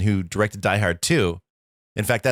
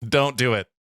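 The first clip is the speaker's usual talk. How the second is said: mock warning; 4 semitones higher than usual. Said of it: "just went".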